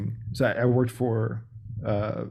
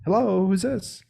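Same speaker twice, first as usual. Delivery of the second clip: high, bright mock-excited hello; super excited; 10 semitones higher, slower than usual. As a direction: answer the phone super excited. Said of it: "they're super excited".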